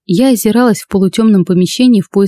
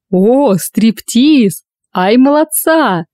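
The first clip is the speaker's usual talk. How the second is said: rowdy heckler voice; 3 semitones higher, much slower than usual. Acted. Heckler shouting from the crowd.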